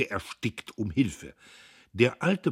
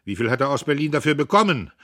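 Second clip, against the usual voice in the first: quick, loud, raised question; heckler's call; 7 semitones higher, 11 dB louder, faster than usual. A narrator's reading. Someone heckles from the office, quick mocking call. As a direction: toss it across the hall.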